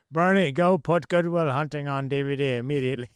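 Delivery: mock-bossy call, pitched well up; high playful order to the dog. To the dog Bernie, a high mock-bossy command. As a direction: play the boss and send the dog off on an errand, voice high.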